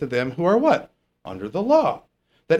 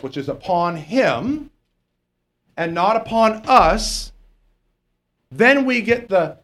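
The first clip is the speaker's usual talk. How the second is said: ordinary talk, nothing marked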